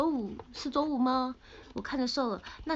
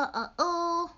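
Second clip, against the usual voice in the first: usual speaking voice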